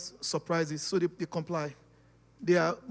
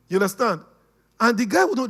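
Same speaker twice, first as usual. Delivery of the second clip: ordinary delivery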